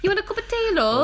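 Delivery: put-on high-pitched caricature London accent; mock British accent. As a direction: offer it in a hammed-up British accent. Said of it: "we don't all sound like that".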